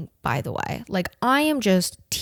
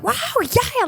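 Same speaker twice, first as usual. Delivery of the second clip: mock hyper girl; 5 dB louder than usual. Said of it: "this bitch behind him is going so crazy".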